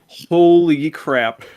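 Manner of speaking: slow, stretched-out disbelief; replayed awe, very drawn out; retold stunned amazement. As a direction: draw out the first word, stunned. Retells stunned reaction to news.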